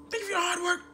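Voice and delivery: bow and thank him in a high voice; imitating the underlings' formal thanks; mock polite greeting. Voicing the new guys bowing.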